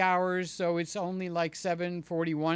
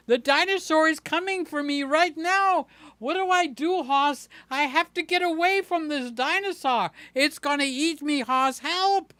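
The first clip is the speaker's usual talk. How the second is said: high, loud hammed-up terror over a dinosaur; fake-scream for help to grab a toddler's attention; hammed-up dinosaur panic rising to cries of help; mock panic; 9 semitones higher, 4 dB louder than usual. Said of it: "Did I get his attention".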